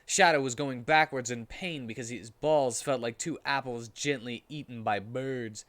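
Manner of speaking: normal talking voice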